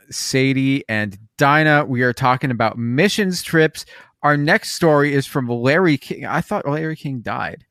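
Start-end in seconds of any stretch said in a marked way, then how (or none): none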